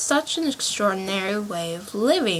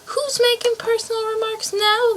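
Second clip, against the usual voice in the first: much higher, gloating question; triumphant; 13 semitones higher than usual. A narrator's reading. Hatter crowing at Alice, triumphant and much higher. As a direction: crow over a point just won. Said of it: "the Hatter asked triumphantly".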